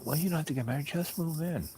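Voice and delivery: mildly mimicked pleading; imitated girlfriend's urging. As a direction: voice her argument up high. Acting out her pleading to him.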